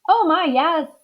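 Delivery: high, delighted yes; eager Amelia. Acted. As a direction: gush with eagerness. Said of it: "said Amelia Bedelia".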